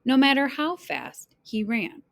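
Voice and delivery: ordinary delivery, nothing marked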